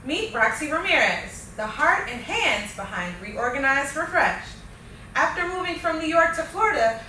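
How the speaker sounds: upbeat, polished show opener; podcast host intro. Acted. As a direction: read it like a show host welcoming listeners.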